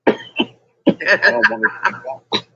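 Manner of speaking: high, slow, laughing mock whine; mock whiny plea. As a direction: stretch it out like a sulky kid, giggling.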